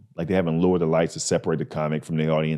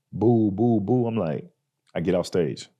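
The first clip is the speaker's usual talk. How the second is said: normal talking voice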